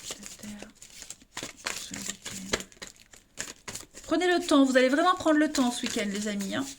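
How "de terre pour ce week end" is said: soft unvoiced whisper. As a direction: breathe the words, barely audible. Repeats phrase, whispered. (chuchoté)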